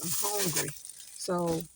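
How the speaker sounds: usual speaking voice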